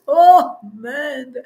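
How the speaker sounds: usual speaking voice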